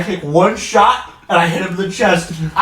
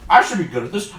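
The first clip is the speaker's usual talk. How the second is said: ordinary delivery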